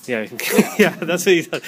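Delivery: ordinary delivery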